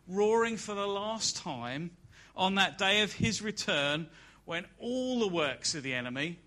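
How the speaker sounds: loud, high, building preacher's cry; full-force preaching. Acted. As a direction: preach it out to the whole hall with rising force. Rising preaching crescendo.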